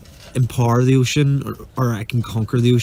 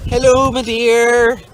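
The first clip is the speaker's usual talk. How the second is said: sing-song hello; 10 semitones higher, 3 dB louder, slower than usual. Real, not acted. Cheery greeting on the boat.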